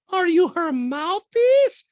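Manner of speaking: loud, high, drawn-out taunt; mock castmate falsetto. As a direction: needle them in a slow squeaky impression. Acted Diana challenging Garcelle.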